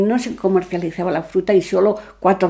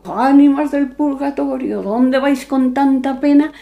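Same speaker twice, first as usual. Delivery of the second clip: slow, higher ghostly chant; slightly disguised voice; 6 semitones higher, slower than usual. Retold or imitated. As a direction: pose as a wailing soul of purgatory. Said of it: "fingiendo un poco la voz".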